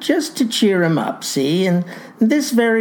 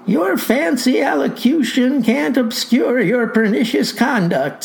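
voiced insolent youth; 7 semitones higher than usual. Boy insults the farmer.

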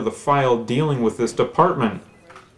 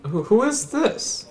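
confused; 9 semitones higher than usual. Acted. Caller confused, odd voice.

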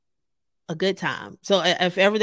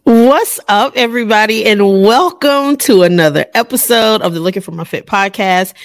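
exuberant welcome; 2 semitones higher, 12 dB louder than usual. Real, not acted. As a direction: belt out a big hello to listeners. Podcast host's opening greeting.

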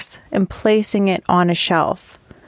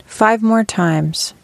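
guided meditation. Acted. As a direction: lead them gently through posture and breath, taking your time.

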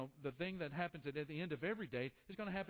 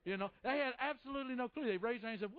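ordinary talk, nothing marked

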